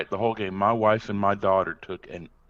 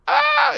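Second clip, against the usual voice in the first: high, loud scared shriek; little girl's startled cry; 6 dB louder than usual. Retold or imitated. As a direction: shriek like a frightened small child. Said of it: "my daughter went".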